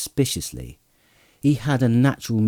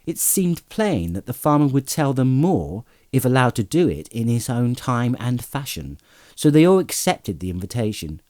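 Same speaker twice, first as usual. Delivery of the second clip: ordinary delivery, nothing marked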